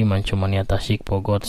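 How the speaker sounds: usual speaking voice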